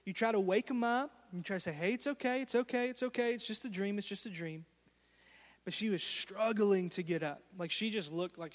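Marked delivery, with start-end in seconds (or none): 1.7-4.7 gentle, soft reassurance, fading softer; calm a scared child back to sleep; softly soothing parent, dropping away; soothing hush. Retold or imitated. Father soothing child from nightmare.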